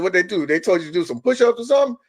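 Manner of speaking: teasing. Retold or imitated. Retold teasing question.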